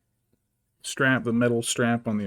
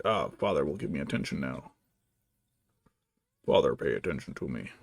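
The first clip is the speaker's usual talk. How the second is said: low, formal voice for a needy pet; Pancakes the cat; 9 semitones lower than usual. Acted. Cat to owner begging attention, in a mock low voice. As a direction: drop the pitch and demand attention. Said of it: "and you're like".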